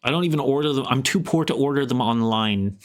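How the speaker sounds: normal talking voice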